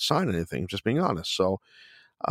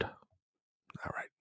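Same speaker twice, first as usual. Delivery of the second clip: whispered aside; 18 dB quieter than usual. Real, not acted. After fixing the misread name.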